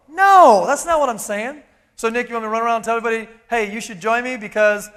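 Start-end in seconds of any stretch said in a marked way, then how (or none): 2.0-4.9 fast mock objection. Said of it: "imagined objector".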